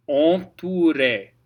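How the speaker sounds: "Entouré" with a clear oo sound; "Entouré" with oo, stress on the final é; French pronunciation. Shown as a demonstration. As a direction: say "Entouré" slowly with a round oo. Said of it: "O U is always oo".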